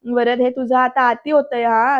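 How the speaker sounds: ordinary talk, nothing marked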